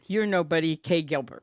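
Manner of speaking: usual speaking voice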